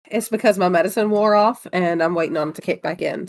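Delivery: ordinary delivery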